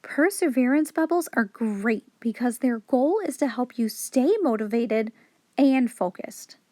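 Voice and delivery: ordinary delivery, nothing marked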